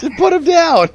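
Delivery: high frantic shout across the game. Real, not acted. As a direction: bark it at your teammate to drop him now. Yelling at teammate in game.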